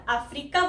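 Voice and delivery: 'अफ्रीका' with long stressed 'ree'; common mispronunciation. Retold or imitated. Teacher quotes how some people say it, mispronounced 'अफ्रीका'. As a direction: imitate the wrong 'a-FREE-ka' for 'अफ्रीका'. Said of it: "इसको हम अफ्रीका नहीं बोलते".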